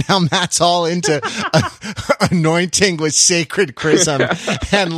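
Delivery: laughing at the idea of himself. Real, not acted. Mocking himself, laughing mid-sentence.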